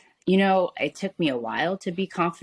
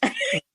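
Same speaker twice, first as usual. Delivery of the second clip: whispered giggle. Real, not acted. Laugh after the whispered confession.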